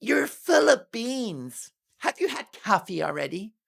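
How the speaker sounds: high, slow, whiny mock-Meghan; mocking impression. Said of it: "she goes".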